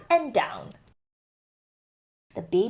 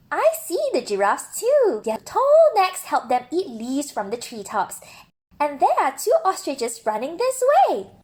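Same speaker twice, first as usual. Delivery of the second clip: higher, brighter-pitched zookeeper stopping him firmly; higher zookeeper voice setting a rule; 6 semitones higher than usual. Acted. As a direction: play the zookeeper, a firm higher voice.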